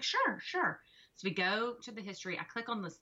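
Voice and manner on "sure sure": breezy eager reply. Retold or imitated. Daughter's breezy reply retold.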